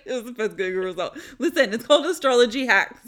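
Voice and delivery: loud, laughing protest. Real, not acted. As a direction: laugh it out defensively. Laughing defense of segment.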